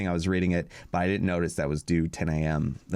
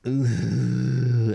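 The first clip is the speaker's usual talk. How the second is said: stung wince; 4 semitones higher, 5 dB louder than usual. Retold or imitated. Reacting to curt late reply.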